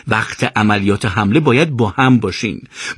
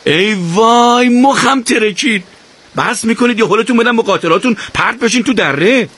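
shouting, exasperated; 10 semitones higher than usual. Acted. Akbar shouting at the bickering riders, exasperated. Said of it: "فریاد زد".

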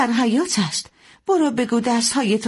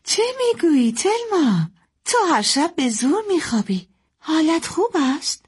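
high-pitched, puzzled mother; surprised concern; 12 semitones higher than usual. Acted. Mother startled her daughter is sleepy so early, high puzzled voice.

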